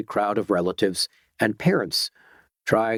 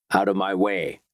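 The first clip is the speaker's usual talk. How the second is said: ordinary delivery, nothing marked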